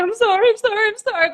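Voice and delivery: very high-pitched, panicky mock apology; retold panic. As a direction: squeak out repeated apologies, imitating your panicked self. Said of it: "my heart was in a panic".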